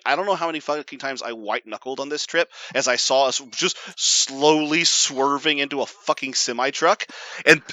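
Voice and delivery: ordinary delivery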